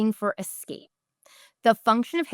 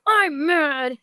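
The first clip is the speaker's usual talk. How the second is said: put-on high, mad child voice; grumpy kid; 8 semitones higher, 3 dB louder than usual. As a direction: imitate a grumpy kid complaining. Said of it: "reinforcing a grumpy face".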